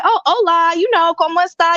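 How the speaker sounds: high, bright-eyed tourist greeting in Spanish; mock tourist Spanish. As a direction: throw out some eager Spanish to connect. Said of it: "and I'm like".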